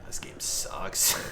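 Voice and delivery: slow whispered "This game sucks"; whispering. Acted. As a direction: breathe "This game sucks" out slowly. Muttered catchphrase.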